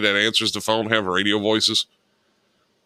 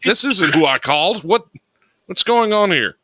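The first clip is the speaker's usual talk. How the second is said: mock confused caller; 7 semitones higher than usual. Confused caller voiced in skit.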